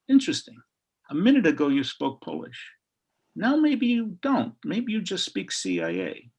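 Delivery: mock-suspicious, high jump on the opening words; suspicious interrogator. Customs woman accusing a traveler of spying, acted suspicion.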